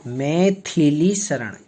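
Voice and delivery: मैथिली शरण said slowly, three syllables in मैथिली; pron. Shown as a demonstration. Teacher models मैथिली शरण for students, slowly. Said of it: "इसको बोला जाता है मैथिली".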